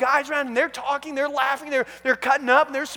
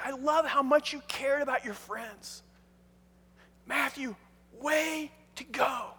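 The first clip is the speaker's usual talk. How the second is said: tender praise; 6 dB quieter than usual. Acted. Jesus with his arm around Matthew after the party, warm and hushed. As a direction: encourage a friend quietly and sincerely, with pauses.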